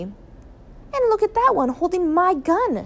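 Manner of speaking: high, drawn-out outraged cry; slow, high-pitched indignant shout. A narrator's reading. Father seeing a duck with his gun, outraged. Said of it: "shouted Mister Gregg".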